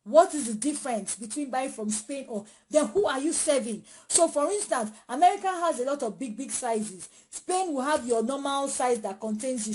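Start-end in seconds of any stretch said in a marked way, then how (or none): none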